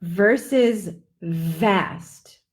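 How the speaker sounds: very slow vast with a buzzed V; slow buzzing V. Shown as a demonstration. Teacher contrasts fast and vast slowly. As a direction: draw out the V in vast.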